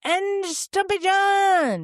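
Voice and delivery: high, slow name call; announcer voice. Acted. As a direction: draw out the name like a host.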